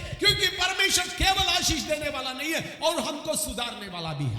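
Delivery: loud, impassioned sermon delivery; full-force Hindi preacher; forceful preaching. Acted. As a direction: proclaim it to a congregation with punched key words. Preaches his emphatic reply.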